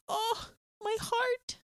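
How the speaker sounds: high, slow little lament; mock heartbreak. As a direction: coo in pained sympathy. Retells reaction to dog getting hurt.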